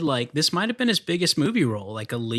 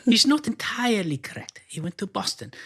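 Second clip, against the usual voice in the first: mock Russian accent; 11 semitones higher than usual. Echoing the coach's line from the clip, whispered Russian accent. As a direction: hiss it like the old Russian skating coach. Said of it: "That's a good accent".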